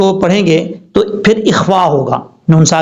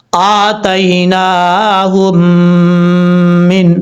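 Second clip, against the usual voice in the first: very slow, drawn-out recitation; slow Quran recitation; much slower than usual. Shown as a demonstration. Teacher reciting the joined word after spelling it out. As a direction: intone the word slowly and carefully. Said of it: "ہا میم پیش ہم".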